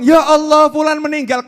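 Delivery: a loud, high shocked cry at the news; loud dismay. Acted. People react to news of your death, loud shocked cry. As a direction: cry out as if just told of a death.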